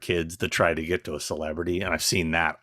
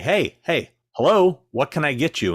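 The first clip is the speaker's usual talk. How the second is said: retold yell; 8 semitones higher, 4 dB louder than usual. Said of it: "like, yell, like".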